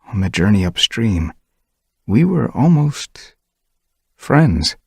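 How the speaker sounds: put-on slow, high, hesitant young tom; wistful confession. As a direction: linger over almost friends.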